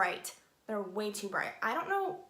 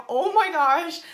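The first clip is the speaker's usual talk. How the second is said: loud, higher panicked exclamation; scared; 5 semitones higher, 12 dB louder, slower than usual. Retold or imitated. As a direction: blurt it out in hammed-up fright. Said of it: "I'm so scared".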